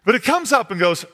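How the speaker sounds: usual speaking voice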